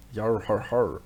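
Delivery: hammed-up pirate "yar har har"; mock sea-dog chuckle; pirate laugh. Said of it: "does that guy really look Scottish?".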